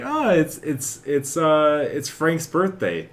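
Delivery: squeaky high excited noticing; bright cartoonish surprise, slowed on the birthday; high happy gasp. Voicing self in game.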